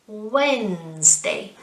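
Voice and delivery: "Wednesday" stretched slowly as Wennnz-day; silent D. Shown as a demonstration. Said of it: "we don't pronounce the D".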